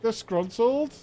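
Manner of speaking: ordinary talk, nothing marked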